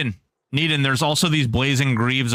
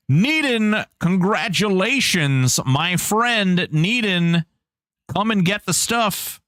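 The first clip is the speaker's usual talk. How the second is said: higher, beaming congratulations; winner announcement; 6 semitones higher, slower than usual. Real, not acted. Streamer congratulating the raffle winner Needon, high excited.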